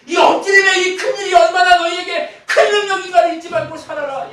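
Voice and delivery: high, loud shouted preaching; full-force preaching. Acted. Preacher shouting God's charge at the church. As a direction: shout it out to the whole congregation.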